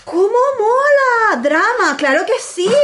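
high, drawn-out squeal of delight; thrilled, stretching the words out; real delighted excitement. Real, not acted. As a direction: gush over a surprise gift. Delighted reaction to an opened gift.

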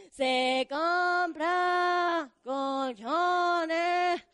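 long, slow drawn-out vendor shout; street vendor call. Retold or imitated. Storyteller imitating the Mexico City scrap buyer, slow drawn-out shout. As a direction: call it out like the scrap-metal truck. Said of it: "El de los fierros viejos".

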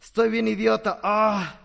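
hammed-up moral-hangover groan; tycoon's hungover regret. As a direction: lament an absurd drunken purchase. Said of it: "para despertar con ese sentimiento".

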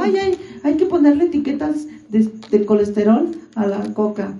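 high, prissy mock complaint; mocking fussy voice. Friend mocking people who want soda labels, prissy voice. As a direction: whine it like a fussy snob.

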